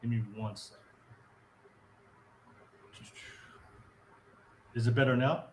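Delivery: mic muffled, voice far off. Real, not acted. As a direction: speak as if through a wall. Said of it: "Sound like muffled or something like".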